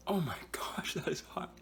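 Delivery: high, whispered gasp from the heat; breathy and pained from chili; mouth burning from spicy food. Real, not acted. Still burning from the pepper.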